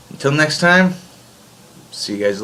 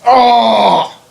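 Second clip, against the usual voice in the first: surprised shout; 9 semitones higher, 4 dB louder than usual. Real, not acted. Misses a burp, cries out.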